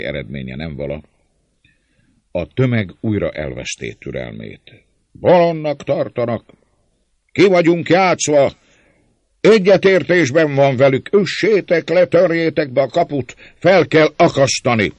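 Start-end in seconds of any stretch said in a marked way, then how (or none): 5.1-14.9 high, loud furious outcries; mob shouting. A narrator's reading. Mob losing patience with András, shouting angrily. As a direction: yell like an enraged crowd. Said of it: "Ezen és hasonló kiáltások".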